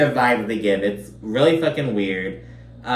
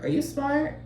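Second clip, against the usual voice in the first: mock incredulous; 7 semitones higher, 5 dB quieter, slower than usual. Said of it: "I'm like".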